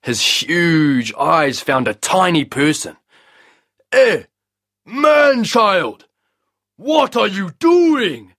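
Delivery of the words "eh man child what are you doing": high, loud booming giant voice; booming sun god challenging a small man. A narrator's reading. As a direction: boom down at the tiny man like a mighty sun. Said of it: "he boomed".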